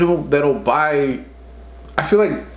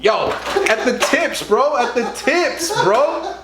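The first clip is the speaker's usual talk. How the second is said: tipsy and giggling; 10 semitones higher, slower than usual. Real, not acted. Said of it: "I'm a little tipsy".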